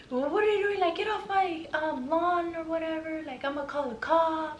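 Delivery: high-pitched imitation of an angry neighbor; mock demanding. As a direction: put on a high, demanding voice, mocking him. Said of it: "Demanding, like, yelling. like, aggressive".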